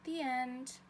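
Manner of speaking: put-on lilting storybook read-aloud for kids; reading to children. A narrator's reading. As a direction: read it like story time for little ones. Teacher reads picture book aloud.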